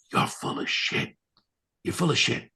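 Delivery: acted half-whisper of disbelief; hushed disbelief. Healer hearing the ankle shrank, hushed disbelief. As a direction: say it under your breath, stunned. Stunned disbelief at healing news.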